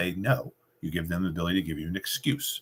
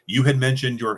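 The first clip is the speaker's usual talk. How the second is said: "You had mentioned your" run straight through, louder and higher, no pause; 6 semitones higher, 5 dB louder than usual. Shown as a demonstration. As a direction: keep "You had mentioned your" flowing into the next words. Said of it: "and don't pause there at all".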